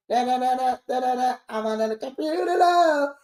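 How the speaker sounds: singing in a thin, far-off robot voice; mock robotic singer. Mocking digital AI music with a robot croon. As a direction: croon it like a computer voice. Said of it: "y'all music is all starting to sound like".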